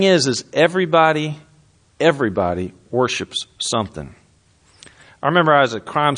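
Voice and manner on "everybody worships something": usual speaking voice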